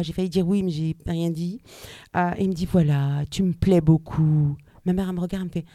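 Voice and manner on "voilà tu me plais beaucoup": slow, low, smooth confession; suave suitor. Retold or imitated. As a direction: imitate a seducer laying it on thick. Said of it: "avec une voix suave". (voix suave, lente et grave de séducteur)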